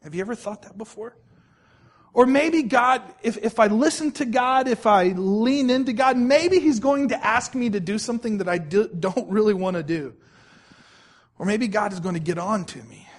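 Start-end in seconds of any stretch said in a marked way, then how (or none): 2.1-10.1 loud, driving sermon delivery; full-voiced pulpit preaching. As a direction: preach it out to the congregation. Preacher building again.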